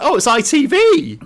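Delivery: high-pitched mock-delighted exclamation; excited recognition. Host acts out spotting British news in a film, excited recognition. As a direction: pipe up with sudden glee.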